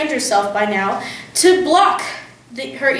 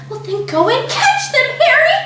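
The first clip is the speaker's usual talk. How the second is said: urgent panicked mother; 12 semitones higher than usual. A narrator's reading. Mother urging husband to catch burglars, high and panicked. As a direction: shove him toward danger.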